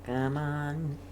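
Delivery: normal talking voice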